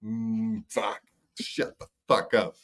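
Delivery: soft, slow muttering; muttered annoyance. Retold or imitated. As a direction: grumble it under your breath, annoyed. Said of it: "you're just going".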